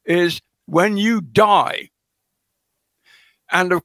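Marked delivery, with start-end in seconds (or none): 0.7-1.9 very slow, weighty When you die; slow, deliberate. Shown as a demonstration. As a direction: draw out When you die at half speed. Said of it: "carefully not saying".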